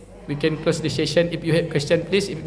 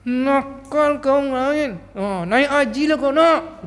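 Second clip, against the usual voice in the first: higher, slow mock scolding of a child; imitating mother; 6 semitones higher, much slower than usual. Imitating her mother scolding little Nain, mock angry. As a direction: play your scolding mum calling you naughty. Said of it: "Gitu mak saya".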